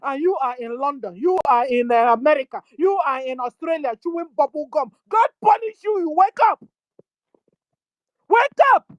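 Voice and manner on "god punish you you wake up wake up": rising to a high, loud shout; building from scolding to a shouted wake up; angry shout. Real, not acted. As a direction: yell at them to wake up. Urging listeners to wake up.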